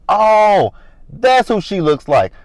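high, loud, drawn-out "aha" moment; exaggerated dawning recognition; sudden realization. Acting out her sudden realization.

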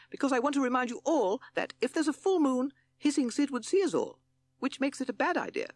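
very high, loud toad voice; protesting toad. A narrator's reading.

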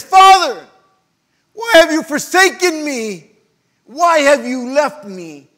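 loud, high anguished cry to the Father; acted cry of abandonment. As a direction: cry it out from the cross, drawn out at the end. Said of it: "he cried that out".

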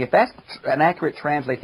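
normal talking voice

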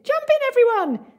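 excited call. A narrator's reading.

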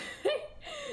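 high relieved laugh. Real, not acted. Streamer relieved after the climb, high burst of laughter.

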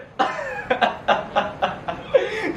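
high cackling stage laugh; put-on scary laughter; mock bogeyman laugh. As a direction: cackle to scare the children. Bogeyman threat to silence the babies.

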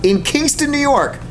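amazed repeat. Real, not acted. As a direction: say it again as if you can't believe it.

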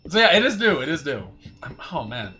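loud excited confirmation. Real, not acted. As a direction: punch it out excitedly. Confirming the new map.

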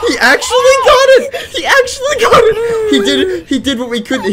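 loud high laughing disbelief; laughing shout. Real, not acted.

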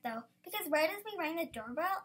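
ordinary delivery

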